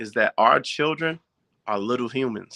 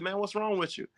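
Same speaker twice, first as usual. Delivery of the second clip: gentle, much softer and higher; eased-up ask; 8 semitones higher, 8 dB quieter than usual. Retold or imitated. Dad easing up on upset son, soft gentle ask. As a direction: soften it, coax him to open up. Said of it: "But I kinda eased up".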